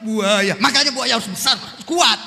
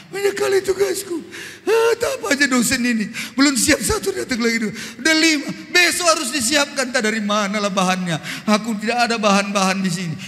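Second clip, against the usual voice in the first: daughter complaining. Retold or imitated. Daughter crying over assignments.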